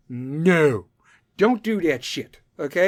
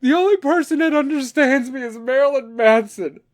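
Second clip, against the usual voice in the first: much higher, mock-dramatic moody teenager voice; whiny emo teen; 10 semitones higher, 4 dB louder than usual. Mocking his teenage self as a dramatic emo kid. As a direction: whine like a tortured grunge kid.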